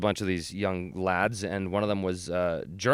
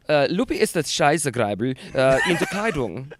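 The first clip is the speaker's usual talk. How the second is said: mock-German yammering; faux German; 4 semitones higher, 4 dB louder than usual. Pretending to translate for his friend, faux German. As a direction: gabble made-up German with confidence. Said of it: "yammering in, in faux".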